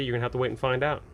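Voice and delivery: normal talking voice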